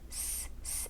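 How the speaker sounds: S, s as a hissed s with no voice; teacher isolating the hissing s; voiceless s sound. Shown as a demonstration. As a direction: hiss S softly without voice. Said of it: "Esse som não tem vibração".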